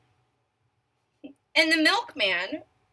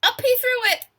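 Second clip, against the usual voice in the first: higher and louder, launching it up; big throw; 12 semitones higher, 7 dB louder than usual. A narrator's reading. Reading to kids, makes the throw exciting. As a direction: fling the words up like the throw.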